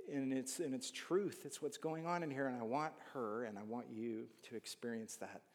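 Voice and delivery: normal talking voice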